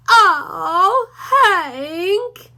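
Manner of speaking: a timid pup whimpering to his boss; scared Drover. A narrator's reading.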